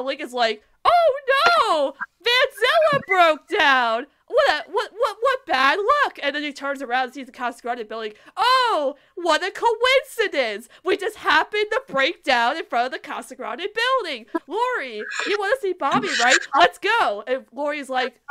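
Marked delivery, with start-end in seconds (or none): 0.8-6.2 loud, high, drawn-out mock shock; hammed-up, badly faked dismay; fake dismay. Acting Lincoln's staged breakdown surprise. 8.4-17.2 loud, high, hammed-up innocence; badly acted surprise, stretched then eager; feigned surprise. Lincoln feigns surprise at coincidence.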